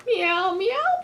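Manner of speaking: high mock cat meowing; playful meow. Reading aloud to kids, high playful meows.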